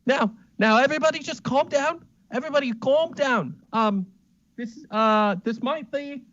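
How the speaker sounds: a nervous little man begging everyone to calm down; flustered high-voiced Smithington. Smithington pleads with the guests in a high, fast, flustered voice. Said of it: "runs up and he goes".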